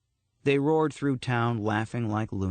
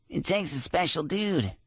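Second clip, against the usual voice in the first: high, scratchy cartoon voice; scratchy like a cartoon character; 7 semitones higher than usual. A narrator's reading. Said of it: "strange, scratchy voice like a cartoon character".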